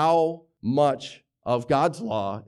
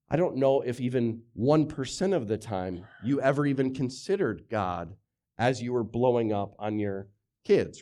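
usual speaking voice

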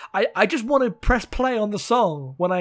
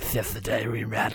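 low, quieter mock dramatic guy; 8 semitones lower, 4 dB quieter than usual. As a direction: imitate the cheesy guy line softly. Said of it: "he's still doing the".

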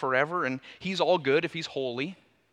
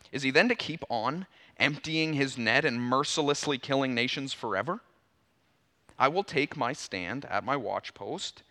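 measured, lower-pitched Bible reading; scripture reading; 3 semitones lower than usual. Acted. Preacher reading the prophet's words to the congregation, measured and low.

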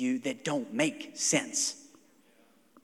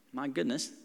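usual speaking voice

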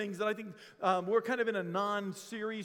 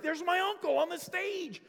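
bright, high-pitched recognition; light up hearing a familiar voice; excited realization, pitched up; delighted recognition; 10 semitones higher than usual. Retold or imitated.

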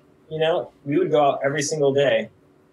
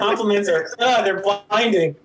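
mock overwhelmed; 8 semitones higher, 4 dB louder than usual. Guest reels from co-host's compliments, laughing mock overwhelm. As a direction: play dazzled by praise, cracking up.

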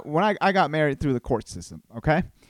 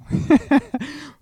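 high chuckle at own joke; amused cackle; laugh; 12 semitones higher, 4 dB louder than usual. Real, not acted. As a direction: burst into a short, high laugh.

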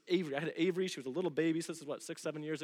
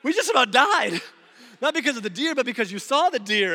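higher, much louder indignant rant; exasperated outburst; 9 semitones higher, 15 dB louder than usual. Retold or imitated. Husband scolding wife for scaring him, exasperated. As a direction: let her have it, half-laughing in disbelief. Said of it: "And I'm like".

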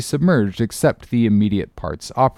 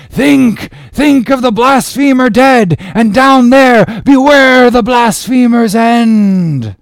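high, loud prophetic denunciation, slowing to drawn-out warnings; vehement exclamation; 13 semitones higher, 8 dB louder, slower than usual. A narrator's reading. Gabriel warning Ahab of the blasphemer's end, high vehement shout. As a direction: cry out a vehement, menacing warning. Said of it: "vehemently exclaimed with downward pointing finger".

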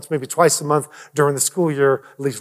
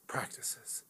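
"Practices" breathed as a whisper; whispered echo; 26 dB quieter than usual. Shown as a demonstration. Pastor repeating the topic to himself, whispered. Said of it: "I'm touching on so many practices here".

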